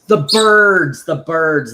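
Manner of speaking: loud, amused echo of the birds; the birds, said with a laughing realization; excited repeat. Real, not acted. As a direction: seize on the answer and repeat it gleefully. Echoing wife's answer about birds.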